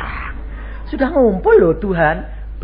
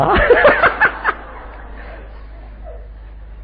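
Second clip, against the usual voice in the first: real laugh. Real, not acted. Preacher laughs at own story.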